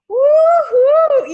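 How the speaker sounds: high, loud whoop of celebration; cheering woo-hoo. Retold or imitated. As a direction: throw up a big mock cheer. Celebrating a small win.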